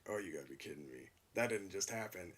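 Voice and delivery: hushed, fast, mortified aside; quick embarrassed mumble; under his breath. Retold or imitated. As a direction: mutter it to yourself, caught out. Embarrassed inner reaction retold.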